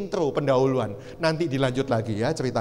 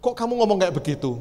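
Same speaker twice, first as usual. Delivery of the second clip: higher-pitched, flustered questioning; retold bewilderment; 6 semitones higher than usual. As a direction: question a crying friend in comic confusion. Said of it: "Saya bingung".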